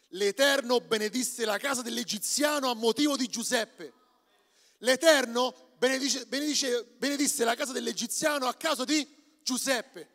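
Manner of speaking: sung-out Bible verse over music; half-sing the verse; scripture reading. Acted.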